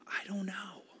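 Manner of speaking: whisper. Acted.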